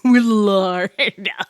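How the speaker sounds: slow, laughing drawn-out Willardo; mocking laugh. Real, not acted. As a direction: savour the silly name Willardo. Mocking the character's name.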